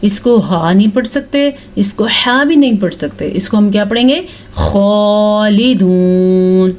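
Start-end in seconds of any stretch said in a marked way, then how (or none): 4.6-6.7 खालिदून with a thick throaty kha, drawn out slowly; heavy kha. Shown as a demonstration. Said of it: "खौ पुर करके पढ़ना है". (खालिदून में खौ मोटा, धीमे से)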